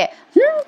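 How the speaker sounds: a knowing little hum; a sly hum with the pitch shooting way up; amused hum. Real, not acted. Narrator reacts to the scene.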